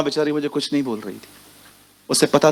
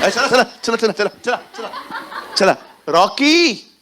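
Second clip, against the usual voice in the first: higher, laughing urgent chant; hurrying call; 5 semitones higher, slower than usual. Acted. Mother calling Rocky in, higher and laughing. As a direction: hurry him along, half-laughing.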